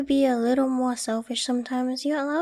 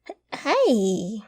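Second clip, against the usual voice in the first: louder, caught-off-guard stammered protest; flustered hey; 5 dB louder than usual. Real, not acted. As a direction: blurt it like you've been teased.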